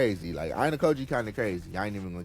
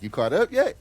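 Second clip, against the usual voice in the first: higher and louder, a playful nagging jab; mock teasing friend; 8 semitones higher, 7 dB louder than usual. Teasing his co-host by imitating how he nagged about Freeman, higher and louder. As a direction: needle him like he used to. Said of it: "I'm just gonna be like how you was for Freeman".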